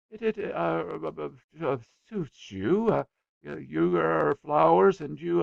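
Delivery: high, halting lovesick stammer; young man tongue-tied before his crush, stammering; flustered stammering. Acted. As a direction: trip over every word, flustered. Infatuated Robert stammering.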